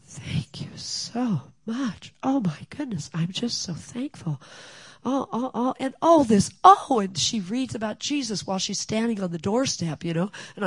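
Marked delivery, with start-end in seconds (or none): 0.1-7.0 put-on high, rising old lady voice, climbing to louder; overjoyed old lady. Elderly neighbor thanking her at the door, high overjoyed gushing. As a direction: gush like a delighted elderly neighbor.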